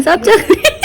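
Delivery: giggling through the words, voice rising high; laughing. Real, not acted. Vlogger to viewers, admitting she tastes every pakora, laughing.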